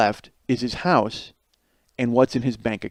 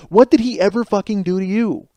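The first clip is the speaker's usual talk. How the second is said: ordinary talk, nothing marked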